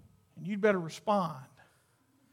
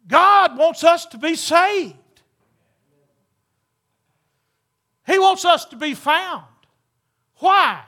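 preaching at full force; 9 semitones higher, 14 dB louder than usual. Acted. Preacher pleads with congregation, full-force preaching. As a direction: lift the church with it.

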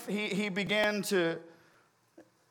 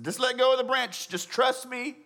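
higher, coaxing repeat; acted insistence; 7 semitones higher than usual. The Lord urges Sam to trust him, acted insistence. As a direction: urge it, pitched well up.

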